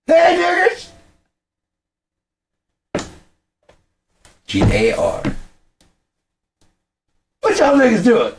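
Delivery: loud, high-pitched shouted sketch voice; Dave Chappelle impression. Fan redoing the comedian's sketch, in a high shouted imitation. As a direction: squeal the greetings, chant out the spelling. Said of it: "a Dave Chappelle impersonation".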